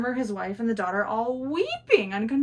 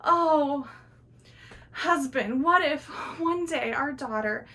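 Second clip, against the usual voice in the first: mother wailing in tears; 6 semitones higher, 4 dB louder, slower than usual. Acted. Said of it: "And the mother goes".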